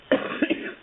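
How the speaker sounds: dry cough off to the side; cough, then excuse yourself. Real, not acted. Teacher getting sick coughs mid-lesson. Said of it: "Sorry, excuse me".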